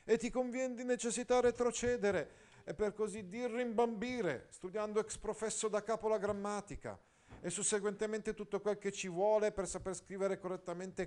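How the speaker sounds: high, admonishing inner voice. Acted. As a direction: scold like a stern voice of conscience, high. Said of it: "una tale segreta voce mi si faceva udire".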